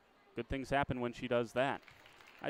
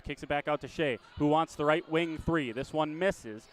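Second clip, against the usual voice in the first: brisk live game call, rising with the action; play-by-play; 3 semitones higher, 4 dB louder than usual. Acted.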